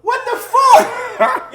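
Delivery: loud high shocked yell; shocked shout. Retold or imitated. Meeting his lost uncle, loud shocked shout. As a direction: shout it in total disbelief.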